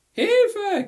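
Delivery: piercing, very high scream for help; shrieking. A narrator's reading. Girl with boy under her skirt, shrieking. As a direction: shriek it at full pitch. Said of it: "kreischte Margaret".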